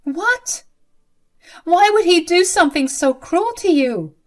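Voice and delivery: very high shocked what; stunned disbelief. Acted. Violet hears Ned locked Mabel in, stunned disbelief. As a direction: gasp it out in disbelief. Learns groom locked her.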